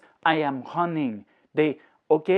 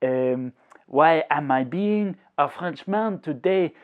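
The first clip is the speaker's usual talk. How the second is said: put-on, hammy French accent; English in a deliberately exaggerated, amateur French accent; ridiculous French accent. Shown as a demonstration. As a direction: speak English like a Frenchman, not a good imitation. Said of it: "why am I doing this ridiculous accent".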